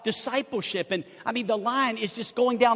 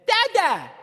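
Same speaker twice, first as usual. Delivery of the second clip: put-on high, loud babyish "Dada"; a grown man saying "Dada" like a toddler; mock grown son; 10 semitones higher, 6 dB louder than usual. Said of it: "walks up to me today and says".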